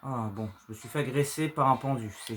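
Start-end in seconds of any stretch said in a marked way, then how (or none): none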